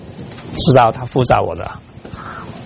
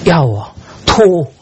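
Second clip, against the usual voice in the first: mock retching; 5 semitones higher, 3 dB louder, slower than usual.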